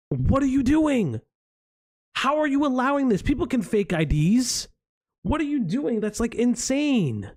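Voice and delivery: high-pitched, incredulous outrage; exasperated rant. Real, not acted. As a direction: demand answers in angry disbelief. Ranting in disbelief at moderation.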